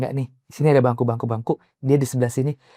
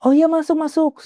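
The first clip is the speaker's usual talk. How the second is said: welcoming host; 13 semitones higher than usual. Retold or imitated.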